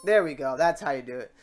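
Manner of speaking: usual speaking voice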